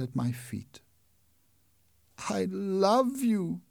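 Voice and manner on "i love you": very slow, high, tender confession; tender declaration. Acted. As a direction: breathe it out at her feet. Eric declares love at her feet.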